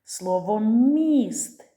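slow word demo. Shown as a demonstration. Teacher sounding out a word for children, slow and loud. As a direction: stretch the word out for listening. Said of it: "Перший звук слова міст".

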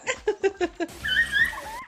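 short high-pitched giggle; high squeaky giggle. Real, not acted. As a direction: let out a tiny squeaky laugh. Laugh after calling capybara toothy.

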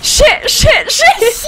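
frantic shout. Real, not acted. Frantic near miss.

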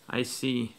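plain reading voice

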